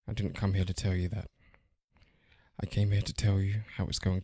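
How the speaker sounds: measured and quiet, long pauses between sentences; Neo. Acted. Matrix monologue recited as podcast intro, quiet and deliberate. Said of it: "That was Neo".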